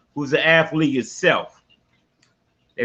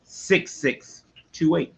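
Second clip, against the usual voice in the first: slow repeat; 3 semitones higher, slower than usual. Shown as a demonstration. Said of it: "Once again".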